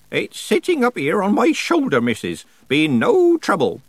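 put-on high, loud old rustic; cheery old countryman. A narrator's reading. Old man shows Nanny his pain, cheery and high. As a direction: report proudly in an old man's voice.

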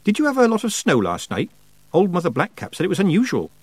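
change the subject brightly; a hint of a nervous girl; Petulia voiced.

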